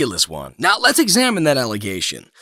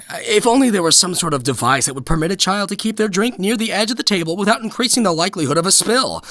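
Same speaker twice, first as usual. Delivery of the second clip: normal talking voice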